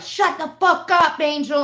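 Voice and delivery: high, loud mock yell at the dog; hammed-up angry dad yelling. Imitating dad yelling at the barking dog, mock yell. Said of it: "he'll be like".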